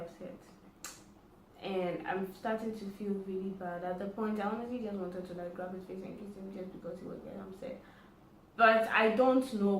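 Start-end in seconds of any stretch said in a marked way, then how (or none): none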